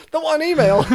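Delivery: laughing. Pretend. Mock excitement, laughing.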